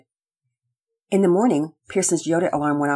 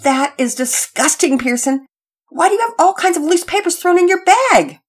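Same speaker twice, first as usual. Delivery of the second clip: disgusted mother; 12 semitones higher, 5 dB louder than usual. Acted. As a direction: spit out the disgust.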